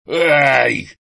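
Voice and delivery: pained yelp. A narrator's reading. Grandfather's wound is touched, a pained yelp. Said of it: "шикнул Крис прямо в ухо".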